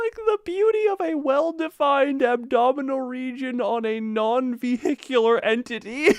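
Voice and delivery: high, sing-song campy drama queen; campy drag queen. Retold or imitated. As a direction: gush it like a diva. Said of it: "Why does he sound like a drag queen?".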